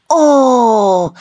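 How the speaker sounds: high, loud drawn-out wonder; big ooh at fireworks; amazed cry. A narrator's reading. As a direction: exclaim in awe. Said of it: "rief Annabella".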